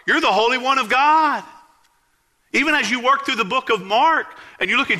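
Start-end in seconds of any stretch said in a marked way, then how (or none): 0.0-1.4 demons crying out. Retold or imitated. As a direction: call it out like a possessed voice. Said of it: "they would cry out in his presence".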